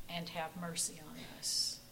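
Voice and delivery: soft, reverent prayer voice. Acted. Pastor leading the congregation in prayer, soft and reverent. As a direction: pray quietly and humbly.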